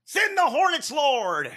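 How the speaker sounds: high, loud cry to the Lord; hammed-up preacher shout; mock preacher. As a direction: call down the hornets like a preacher. Repeats catchphrase parody.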